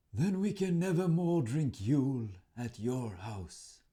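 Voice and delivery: a hint of a slow, resigned troll; mild troll disappointment; defeated troll. A narrator's reading. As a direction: sigh it like a beaten troll. Troll gives up sadly.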